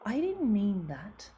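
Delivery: sulky daughter denying it; teenage daughter answering her mother, mildly whiny; defensive teenager. Retold or imitated. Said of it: "And she goes".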